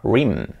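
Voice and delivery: "rim" as one drawn-out word; one-word "reem"; mistaken pronunciation. Shown as a demonstration. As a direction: say rim as a single syllable. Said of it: "pensaba que se pronunciaba".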